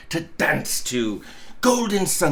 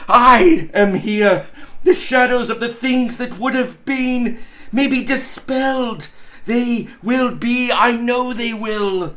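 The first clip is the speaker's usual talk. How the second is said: put-on old Scrooge, higher and louder, bursting with relief; a miser who woke up alive, joyful and raised; Scrooge, overjoyed; 4 semitones higher, 4 dB louder than usual. Scrooge hugging his bed curtains, crying out with joy.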